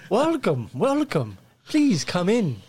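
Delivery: high, eager peasant greeting; peasant villager. Acted. GM greets players as humble peasant, high and eager. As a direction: welcome the lords like a fawning villager.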